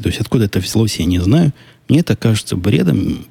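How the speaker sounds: usual speaking voice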